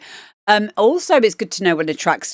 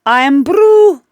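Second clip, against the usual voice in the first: a high, loud mock Scottish voice; put-on Scots, louder; a hammed-up Scottish accent, pitched high; 7 semitones higher, 8 dB louder than usual. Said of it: "that's how he talks".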